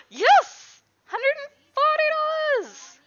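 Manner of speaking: squealing with joy at the money; thrilled shout. Real, not acted. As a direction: cheer the big sale at the top of your voice. Big sale in game.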